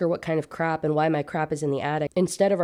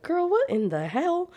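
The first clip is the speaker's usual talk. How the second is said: shocked sister; 12 semitones higher than usual. Retold or imitated. Sister's shocked reaction voiced.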